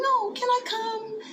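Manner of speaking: put-on high, slow child pleading; little girl insisting. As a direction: stretch it out like a kid who won't give up. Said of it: "and I said".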